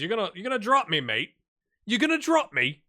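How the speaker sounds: mock Leeds accent, loud and high; a player yelling in a Leeds accent. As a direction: shout it in a broad Leeds accent. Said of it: "that's the Leeds accent".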